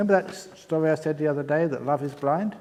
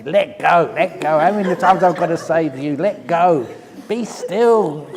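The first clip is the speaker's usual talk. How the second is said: louder, insistent repeated commands to let go; mock exasperated urging; 2 semitones higher, 6 dB louder than usual. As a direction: push the words out like an exasperated teacher shaking a student. Monk urging a student to let go.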